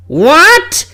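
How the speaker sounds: sudden high startled what; surprised. Acted. Fudge startled by the representative, surprised yelp. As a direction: blurt it out in shock.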